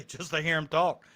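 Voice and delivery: ordinary talk, nothing marked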